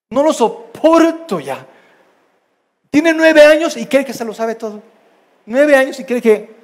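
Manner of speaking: loud, high-pitched grumbling; exasperated parent. Acted. Parent venting about a nine-year-old child, exasperated.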